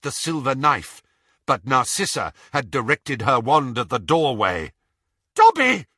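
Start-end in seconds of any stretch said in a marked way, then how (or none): 5.3-5.9 high, loud scream of a name. A narrator's reading. Bellatrix spotting the elf, screaming. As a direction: scream it in shock. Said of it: "she screamed".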